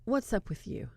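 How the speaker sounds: soft breathy teasing; quiet come-on, close to his face; seductive hush. Retold or imitated. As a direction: lean in and murmur it. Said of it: "gets right in his face".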